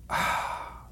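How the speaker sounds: soft satisfied exhale; satisfied breathy ah. A narrator's reading. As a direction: sigh of relief after a drink. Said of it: "a satisfied".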